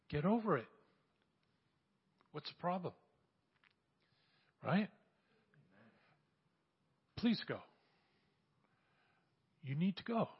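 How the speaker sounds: ordinary delivery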